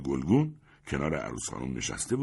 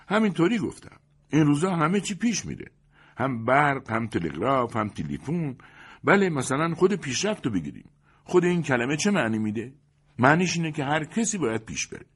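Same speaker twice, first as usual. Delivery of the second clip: high, lively best man voice; a pushy best man lecturing the guest, pitched up; 9 semitones higher, 3 dB louder than usual. A narrator's reading. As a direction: chatter on cheerfully like a know-it-all.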